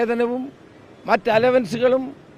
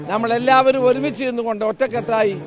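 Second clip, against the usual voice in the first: ordinary talk, nothing marked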